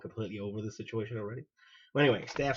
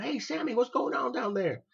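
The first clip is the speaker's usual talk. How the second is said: a hint of a captain calling from down the hall, higher; lightly voiced officer hailing him; captain hears commotion and calls down the hall, mildly voiced; 12 semitones higher, 4 dB louder than usual.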